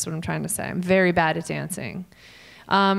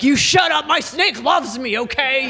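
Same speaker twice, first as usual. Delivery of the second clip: higher, louder indignant snake owner; mock defensive owner; 5 semitones higher, 4 dB louder than usual. Comic voicing the snake owner telling off the host, mock indignant. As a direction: huff it out like a touchy pet owner.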